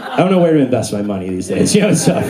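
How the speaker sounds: ordinary delivery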